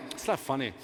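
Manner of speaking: low, quiet mock disapproval; lightly voiced deadpan objection, soft and low; unamused women. Women in the audience objecting to the joke, low mock disapproval. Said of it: "Some women are like".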